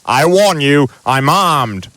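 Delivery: loud, high warning; threatening. Acted. Uncle Vernon warning whoever is at the door. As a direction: brandish the threat loudly.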